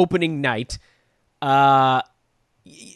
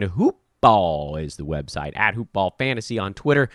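ordinary talk, nothing marked

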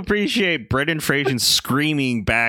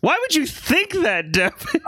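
jealous paranoid god; 10 semitones higher than usual. Pretend. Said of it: "a bit of a paranoid god".

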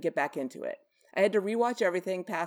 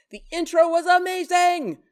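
much higher and louder, bursting with excitement; excited; 12 semitones higher, 8 dB louder than usual. Acted. Host reading a fan's comment with a burst of excitement. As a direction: shout it like a thrilled fan.

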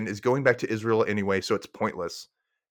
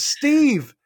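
high, loud proud call to Steve; proud announcing; 13 semitones higher, 6 dB louder, much slower than usual. Acted. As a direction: announce her name to the group, proudly. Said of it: "turns to the group and says".

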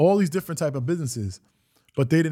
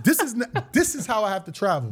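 laughing; 6 semitones higher than usual. Retold or imitated. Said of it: "I was like".

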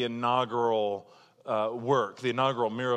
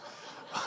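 short breathy laugh; 12 dB quieter than usual. Real, not acted. Laughing at own joke.

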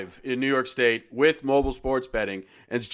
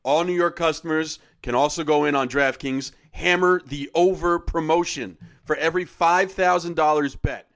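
upbeat sponsor read, punchy; 3 semitones lower, 6 dB louder than usual. Acted. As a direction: sell it like a radio spot.

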